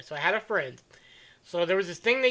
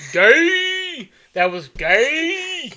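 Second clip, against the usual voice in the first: higher, loud jeer, the second line slowed right down; mock old-man jeer; 8 semitones higher, 4 dB louder, much slower than usual. Future old friend jeering at a fallen man in a nursing home, loud and drawn out.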